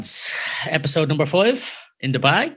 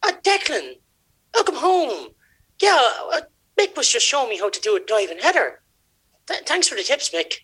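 higher, awkward guilty voice; flustered dad; 10 semitones higher than usual. Acted. Dad caught in the bedroom, squeaky guilty cover-up.

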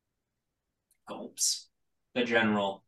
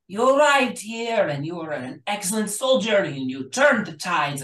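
commanding, raised general's voice, cold and disappointed; harsh-looking general chewing out her soldier; stern general; 7 semitones higher, 5 dB louder than usual. Acted. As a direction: scold a failing soldier from high above.

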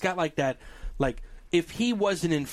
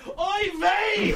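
Yiddish exclamation. Retold or imitated. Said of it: "and I almost went".

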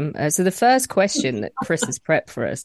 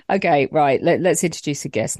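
ordinary talk

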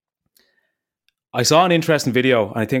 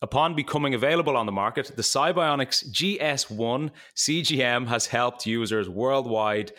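ad read; 7 dB quieter than usual. Acted. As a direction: read it like a podcast ad. Sponsor ad read before show.

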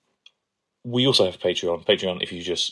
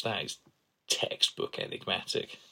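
whispered Doctor quote; 9 dB quieter than usual. Retold or imitated. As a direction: hush it out, savouring textbook. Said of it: "It's such a great phrase".

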